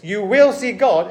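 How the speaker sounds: ordinary talk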